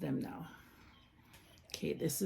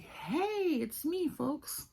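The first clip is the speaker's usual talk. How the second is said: much higher, slow cutesy princess voice; Rapunzel voice; 10 semitones higher, much slower than usual. Pretend. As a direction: play the toy saying hi. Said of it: "Rapunzel's like".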